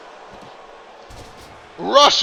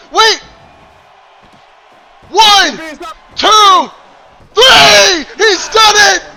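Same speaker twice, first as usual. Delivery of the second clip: high, loud drawn-out count; long goal yell; 11 semitones higher, 12 dB louder, slower than usual. Real, not acted. Pin count, match ends.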